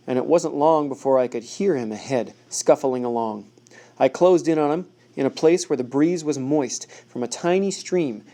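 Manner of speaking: usual speaking voice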